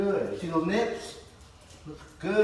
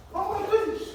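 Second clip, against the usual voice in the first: shrieking high praise; excited. Shown as a demonstration. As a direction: explode with joy at the dog. High-energy praise for the dog.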